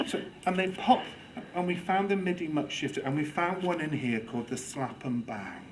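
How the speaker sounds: ordinary talk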